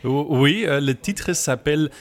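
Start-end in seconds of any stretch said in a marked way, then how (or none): none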